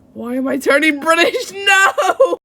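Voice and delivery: loud, rising exasperated outburst; laughing dismay. Real, not acted. Voice actor losing the accent, loud laughing dismay. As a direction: cry out half laughing at yourself.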